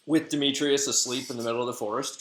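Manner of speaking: plain reading voice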